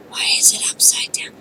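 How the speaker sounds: soft puzzled whisper; whispered question. Real, not acted. Player baffled by the upside-down room, soft whisper. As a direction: whisper it to yourself.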